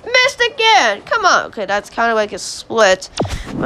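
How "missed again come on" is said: high-pitched frustrated outburst; real frustration, pitched up; exasperated complaint. Real, not acted. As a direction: whine at the game after another miss. Player misses again.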